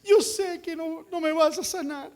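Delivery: high, pleading, self-pitying whine; whiny hopeless prayer. Retold or imitated. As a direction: beg God while expecting no. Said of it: "nos arrodillamos y oramos y le decimos".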